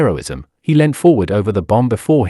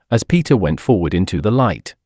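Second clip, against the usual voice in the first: ordinary delivery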